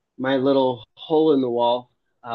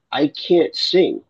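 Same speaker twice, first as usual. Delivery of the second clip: slow protest; 3 semitones higher, 3 dB louder, much slower than usual. Retold or imitated. Objects he can't sing.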